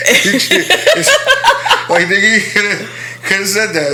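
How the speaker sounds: high laughing tease; real laughing tease. Real, not acted. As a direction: laugh through it while teasing. Laughing at partner.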